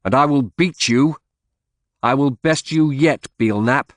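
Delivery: high, loud challenge called after him; a defiant shout that keeps climbing. A narrator's reading. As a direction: throw the threat at his back. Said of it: "I shouted after him".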